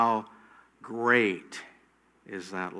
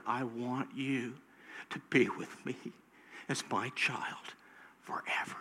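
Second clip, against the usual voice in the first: tender whisper; 3 semitones lower, 7 dB quieter than usual. Pretend. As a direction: breathe God's promise gently. God's voice in intimate whisper.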